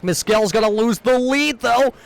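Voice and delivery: high, excited race call; motorsport commentator. Real, not acted. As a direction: keep the energy up through the finish. Leader crashes, call continues.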